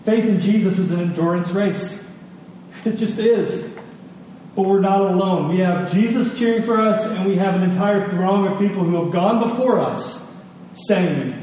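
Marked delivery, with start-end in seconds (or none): none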